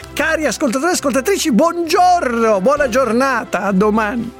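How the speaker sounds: fast, bright cheery host farewell; radio sign-off. Real, not acted. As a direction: sign off the show with a big smile. Radio host signs off listeners.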